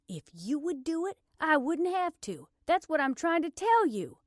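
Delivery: high, loud, mounting exasperation; exasperated. Acted. Blair blaming the officer for inaction, exasperated.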